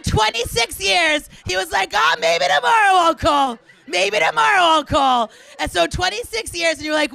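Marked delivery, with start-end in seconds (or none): 1.9-5.3 loud, mocking put-on voice; hammed-up noncommittal guy; procrastinating man. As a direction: act out putting it off, loudly. Mimics procrastinating man putting off call.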